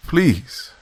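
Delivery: high, falling tearful plea; beg, pitch leaping up; sobbing plea. A narrator's reading. Commander begging Zudov over radio, tearful plea. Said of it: "I sobbed once again".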